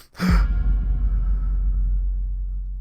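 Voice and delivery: sudden gasp for air; sharp gasp. Acted. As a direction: gasp as if jolted awake. Waking in shock.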